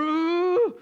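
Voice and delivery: put-on high, loud angry child squeal; rage monster grunt. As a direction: imitate a furious little boy. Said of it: "he goes rage monster".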